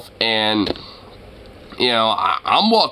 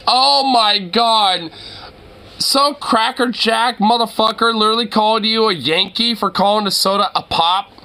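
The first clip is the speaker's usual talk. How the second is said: high, incredulous exclamation; amused outrage; 7 semitones higher than usual. Real, not acted. Streamer to viewer called a Yankee over pop, amused outrage. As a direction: draw out the disbelief, then repeat it amazed.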